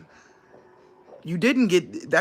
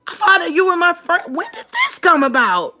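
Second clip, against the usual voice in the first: shocked; 10 semitones higher, 5 dB louder than usual. Retold or imitated. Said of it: "To where I went".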